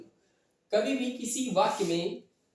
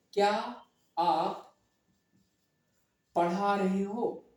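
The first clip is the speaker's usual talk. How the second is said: क्या, आप, पढ़ा रहे हो said slowly with pauses between words; much slower than usual. Shown as a demonstration. Teacher spelling out the question for students, slowly. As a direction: space out क्या and आप with long pauses, then stretch पढ़ा रहे हो. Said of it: "ध्यान से समझना".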